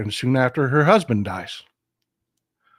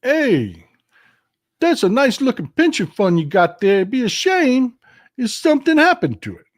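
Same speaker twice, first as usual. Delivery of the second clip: menacing mobster voice, pitched up; smooth loan shark threat, rising higher; Wise Guy Jimmy; 9 semitones higher than usual. Retold or imitated. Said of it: "this is why I call him Wise Guy Jimmy".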